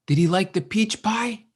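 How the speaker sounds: eager mataji asking about her pie; eager question. Retold or imitated. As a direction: ask hoping for good news.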